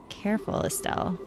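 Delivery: whispered warning. Real, not acted. Streamer warning Estelle about the flirt, whispered. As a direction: hush it like a secret caution.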